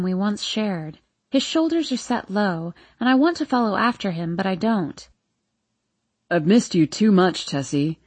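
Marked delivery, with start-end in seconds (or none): 6.3-8.0 father's voice. A narrator's reading. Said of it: "my father asks".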